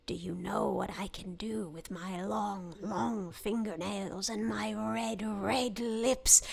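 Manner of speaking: low, slow, whispery witch. Acted. Witch at a kid's window at night, slow creepy whisper. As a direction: draw out each menace in a hushed low croak. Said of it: "That's a good witch voice".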